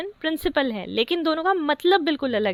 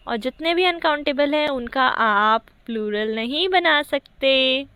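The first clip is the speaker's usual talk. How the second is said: usual speaking voice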